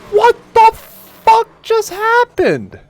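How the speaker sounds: very high, loud, slow stunned scream; shocked yell. Real, not acted. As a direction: scream it out in disbelief. Crash seen on stream.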